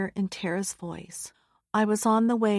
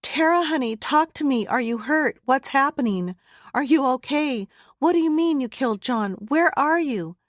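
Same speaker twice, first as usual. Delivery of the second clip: much higher and louder, panicked questions; mother begging her hurt daughter to answer, raised and panicky; frantic pleading; 5 semitones higher, 4 dB louder than usual. Acted. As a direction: fire questions at her, desperate.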